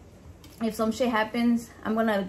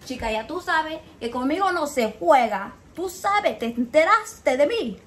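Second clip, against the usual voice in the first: playful put-on Cuban Spanish accent, a bit louder; sassy Cuban accent she does to be funny; 3 semitones higher, 5 dB louder than usual. Shown as a demonstration. Showing viewers her joke bit for Mandy, sassy Cuban accent. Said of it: "with my little accent".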